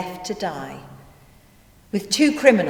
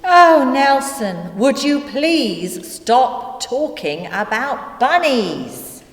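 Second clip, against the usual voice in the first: exasperated plea; 5 semitones higher, slower than usual. Acted. Narrator to Nelson who keeps on about bunnies, exasperated. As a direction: plead slowly, at the end of your patience.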